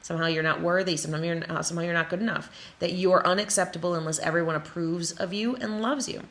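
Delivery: normal talking voice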